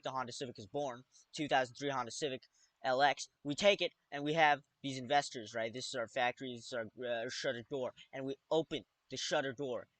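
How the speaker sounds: usual speaking voice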